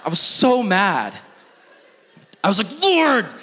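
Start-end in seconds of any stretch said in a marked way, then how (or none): 2.8-3.3 exasperated cry. Retold or imitated.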